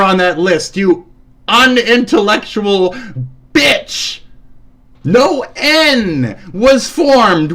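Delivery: real anger, stretching the words; scathing insult. Real, not acted. Insulting an online opponent.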